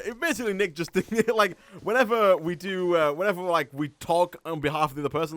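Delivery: ordinary delivery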